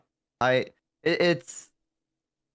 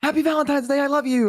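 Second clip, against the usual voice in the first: high-pitched lovey-dovey greeting; acted gushing greeting; 12 semitones higher than usual. Boyfriend surprising girlfriend on Valentine's, high acted gushing. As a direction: sing it out sweetly, pitched well up.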